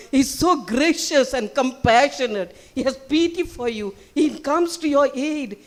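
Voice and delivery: impassioned sermon delivery; fervent preaching. Real, not acted. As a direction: proclaim it loudly to the congregation. Preaching after the story.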